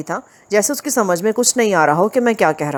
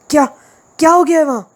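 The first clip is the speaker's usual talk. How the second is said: startled, much higher and louder; anxious blurted question; 8 semitones higher, 4 dB louder, faster than usual. Acted. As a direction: blurt it out in alarm. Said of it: "इसतरारी लहजे में बोला". (घबराकर, ऊँची आवाज़ में)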